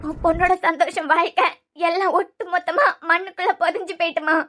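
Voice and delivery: soft, broken crying voice; tearful despair. Acted. Daughter telling mother her life is over, tearful despair. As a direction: lament it quietly through tears. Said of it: "இப்படி அழுதுகிட்டு இருக்க".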